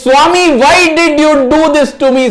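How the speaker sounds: loud, very high-pitched protest; acted distraught wail of reproach; anguished outcry. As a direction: plead as if breaking down before your master. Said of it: "breaks down sobbing uncontrollably like a child".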